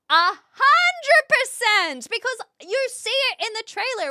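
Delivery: high, loud, drawn-out emphasis; emphatic agreement. Real, not acted. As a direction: belt it out slowly in wholehearted agreement. Emphatic yes answering viewer's question.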